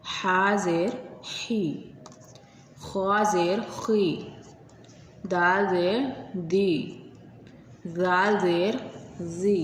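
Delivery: each letter drawn out slowly, syllable by syllable; slow, patient drill of letter plus zer; slow spelled recitation. Shown as a demonstration. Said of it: "तो इसे हम इस तरह पढ़ेंगे".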